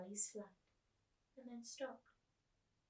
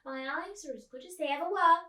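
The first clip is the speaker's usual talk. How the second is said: loud, high and triumphant; old woman crowing to her friend, triumphant; 7 semitones higher, 13 dB louder than usual. Acted. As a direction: crow about being right. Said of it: "said Miss Spink triumphantly".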